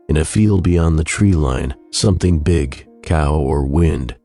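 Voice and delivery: plain reading voice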